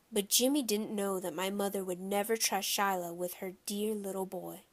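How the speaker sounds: plain reading voice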